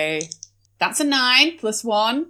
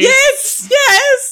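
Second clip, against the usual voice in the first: high posh lady voice; 4 dB louder than usual. Acted. Posh character answers.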